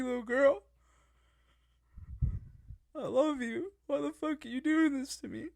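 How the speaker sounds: building from tense questions to high, loud desperate cries; retelling a panicked plea, rising to near yelling; frantic pleading. Retold or imitated. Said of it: "just freaking out, like".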